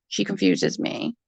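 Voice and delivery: breathy unvoiced aside under the breath; muttered aside. Real, not acted. Muttering to her viewers about the rival. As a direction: mutter it to yourself.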